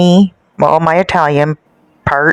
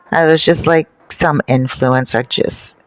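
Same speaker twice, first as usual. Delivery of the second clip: usual speaking voice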